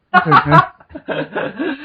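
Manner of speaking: very high, drawn-out, laughing character voice; slow, dreamy bear voice, breaking into a laugh; Winnie the Pooh voice. Pretend. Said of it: "You sound like Winnie the Pooh".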